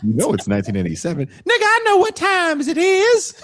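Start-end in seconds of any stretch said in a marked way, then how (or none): 1.3-3.4 very high, loud put-on seventies jive voice; seventies movie jive talk. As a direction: ham up an old movie hero's jive talk. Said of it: "why are you talking like this".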